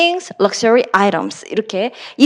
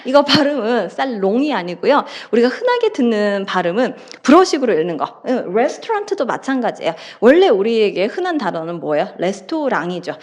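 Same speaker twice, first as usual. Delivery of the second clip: ordinary talk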